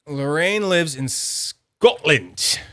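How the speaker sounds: ordinary talk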